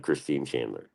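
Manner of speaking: ordinary talk, nothing marked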